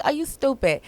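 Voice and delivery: high-pitched playful sibling jab; snide little sister. Retold or imitated. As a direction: toss out a teasing sisterly dig. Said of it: "little sister snide comments".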